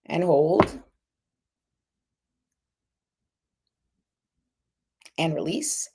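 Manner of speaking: calm, slow breathing cues; steadying breath. Shown as a demonstration. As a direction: lead the breath gently. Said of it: "let's do that one more time".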